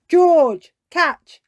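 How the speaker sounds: loud high call across the beach. Acted. Daddy Pig calling George to catch the ball, loud. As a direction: call to George as you throw.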